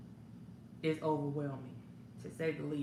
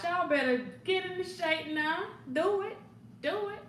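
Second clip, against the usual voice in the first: high, excited egging on; playful urging; 10 semitones higher than usual. Real, not acted. Hostess egging viewers to work out, high and playful. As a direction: hype them up, pitch way up.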